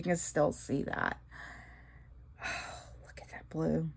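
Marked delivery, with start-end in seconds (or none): 2.4-3.9 soft admiring whisper. Real, not acted.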